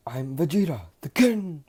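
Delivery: proud boast. Acted. Vegeta introduces himself to Midoriya, loud proud boast. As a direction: announce yourself as king. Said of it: "This man says".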